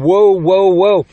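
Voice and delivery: prophetic woe cry. Retold or imitated. Preacher voicing the prophet crying woe at the nations, loud. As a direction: proclaim woe like an Old Testament prophet.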